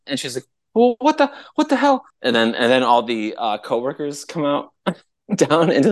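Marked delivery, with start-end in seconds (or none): 0.7-2.0 very high, mock outrage; outraged woman. CEO hearing her car gets booted, mock outraged. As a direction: imitate a woman squeaking in disbelief.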